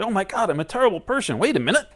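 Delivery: high, loud, quick fretting that catches itself; mock self-reproach. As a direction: fret in a rush, then pull up short.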